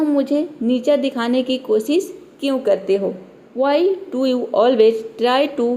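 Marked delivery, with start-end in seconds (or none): 3.6-5.7 each word said very slowly and apart; slow, deliberate teacher's reading; slow clear model sentence. Shown as a demonstration. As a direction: read it out slowly for learners to copy. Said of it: "मैंने यहाँ पे pronounce कर दिया".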